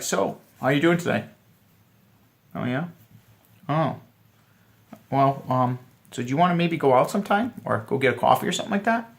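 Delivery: stiff, halting pickup line; wrong way, awkward. Shown as a demonstration. Coach showing the awkward way to ask out. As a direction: show how not to ask her out. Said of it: "here's the wrong thing to do".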